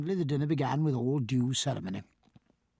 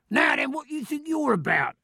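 much higher angry call across the deck; called out angrily; 9 semitones higher than usual. Acted. Killick scolds a cook's mate on deck: angry high call. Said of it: "he called out angrily".

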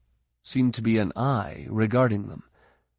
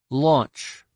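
commanding; 4 semitones higher, 4 dB louder than usual. A narrator's reading. Ben commands vehicle.

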